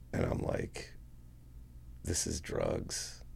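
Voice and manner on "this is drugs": low, slow, soft murmur; retold grim realisation. Son realising why police raided dad's house, grim murmur. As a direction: drop right down and say it slowly to yourself.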